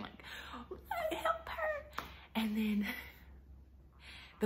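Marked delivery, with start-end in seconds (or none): 1.1-1.9 high squeal of imitated students; mock panic. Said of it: "students start kind of panicking".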